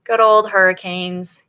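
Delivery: ordinary talk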